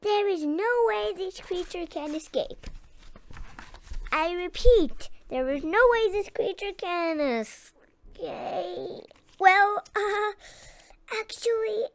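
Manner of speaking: high-pitched showman insisting, then faltering; squeaky mouse doctor. A narrator's reading. Mouse doctor reassures crowd.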